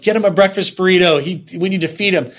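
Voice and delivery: high, fast, loud urgent call; urgent excitement. Retold or imitated. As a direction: rush it out, worried and high. Coworkers urging food for him.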